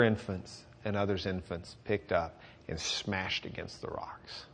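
whispered, slow and grave; hushed. Real, not acted. Preacher hushed, describing infants smashed on rocks. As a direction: drop to a stricken whisper. Grim retelling of infants' deaths.

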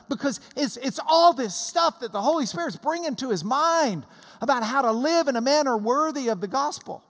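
ordinary talk, nothing marked

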